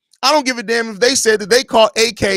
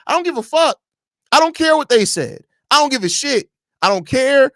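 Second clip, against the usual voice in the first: heated rant; 6 semitones higher than usual. Real, not acted. Podcaster scolding caller Vern.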